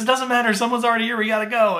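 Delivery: husband hurrying his wife to leave, mock urgent; hurried husband. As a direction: hurry her along in a mock panic.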